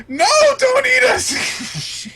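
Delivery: very high, slow, loud squeaky plea; tiny pastry begging for its life; shrill drawn-out pastry wail; terrified cream puffs. Retold or imitated. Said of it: "they're like".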